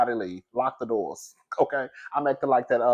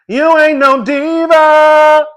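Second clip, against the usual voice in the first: calling out; 10 semitones higher, 8 dB louder, much slower than usual. Acted. Scolding a fan in crowd.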